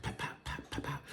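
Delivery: breathy pop sounds; whispered popping. Retold or imitated. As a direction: whisper the pops softly. Imitates oil popping.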